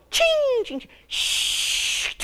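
high ringing chings into a long shush; high metallic pings, then a sliding hiss; imitated clatter. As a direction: mimic keys skittering down a metal roof. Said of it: "So they go".

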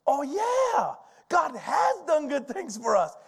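mock excited teens. Young people imitated, excited.

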